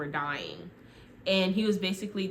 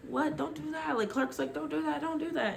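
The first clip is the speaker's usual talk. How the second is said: panicked protest; 8 semitones higher, 3 dB quieter than usual. Retold or imitated. Friends panic as she strips.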